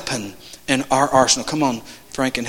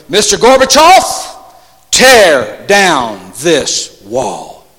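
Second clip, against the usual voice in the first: weighty, drawn-out imitation of a statesman; oratorical quote; 7 dB louder, much slower than usual. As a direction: deliver it like a famous speech, word by word. Quoting a famous political speech.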